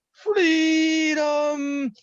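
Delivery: high, ringing battle cry; rally the troops with one huge cry; Mel Gibson in Braveheart. Retold or imitated. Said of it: "He'd be the one at the front going".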